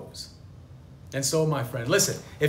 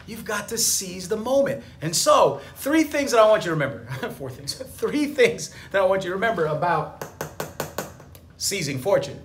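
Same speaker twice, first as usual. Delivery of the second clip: ordinary talk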